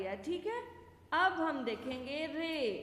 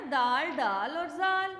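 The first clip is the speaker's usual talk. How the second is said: each letter name said slowly and louder, दाल, डाल and जाल apart; teacher drawing out दाल, डाल, जाल one by one; slow careful naming; 6 dB louder, slower than usual. Shown as a demonstration. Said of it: "तीन होते हैं ना".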